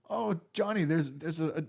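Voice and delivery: lightly put-on German accent, high, laughing through it; a hint of a German guy offering cake. Assistant offering Johnny zucchini bread in a mock German accent. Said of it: "like, a German guy".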